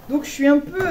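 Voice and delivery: loud laugh while speaking; laughing excitedly. Real, not acted.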